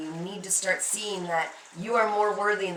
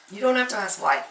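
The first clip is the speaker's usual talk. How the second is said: ordinary delivery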